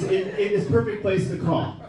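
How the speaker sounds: ordinary delivery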